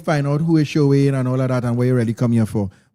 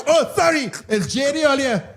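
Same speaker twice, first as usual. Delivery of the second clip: apologetic shout; 9 semitones higher than usual. Retold or imitated. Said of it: "So, yeah".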